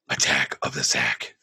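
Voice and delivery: low gravelly whispered Batman growl; hipster Batman voice. Shown as a demonstration. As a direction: growl the title like an ironic Batman. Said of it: "My hipster Batman voice".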